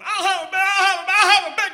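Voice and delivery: high, loud chanting repeats; hammed-up preacher in triplicate; preacher dad ordering. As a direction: order fast food like a fireball preacher. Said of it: "Here's my dad ordering".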